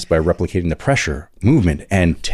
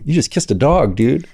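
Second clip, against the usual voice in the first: higher, teasing mock announcement; playful higher-pitched gotcha; gleeful prank call; 6 semitones higher than usual. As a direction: break the news to a pranked pal, teasing. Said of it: "then you call them".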